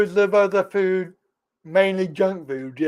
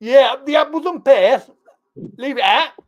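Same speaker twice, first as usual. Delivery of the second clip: higher, hammed-up Cockney; Cockney accent; 6 semitones higher, 4 dB louder than usual. Host showing off after a compliment, Cockney accent. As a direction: lay on the Cockney slang cheekily. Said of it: "you do a good Cockney accent".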